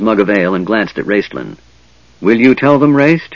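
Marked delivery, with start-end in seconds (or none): none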